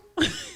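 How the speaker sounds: high squeal of laughter; real high-pitched laughing squeal. Real, not acted. Laugh at own retold question.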